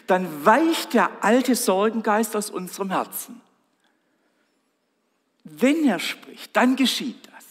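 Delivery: loud, rising pulpit preaching, every Jesus quote hammered home; preaching at full force. Acted. Preacher proclaiming Jesus' words to the congregation, loud and fervent.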